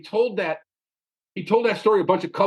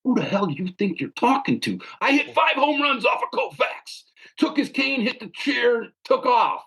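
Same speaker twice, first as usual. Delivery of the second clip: imitating an indignant father, pitched higher and rising; mock offended dad; 6 semitones higher than usual. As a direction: ham up the offended old ballplayer. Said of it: "he looked at me, like, with these eyes".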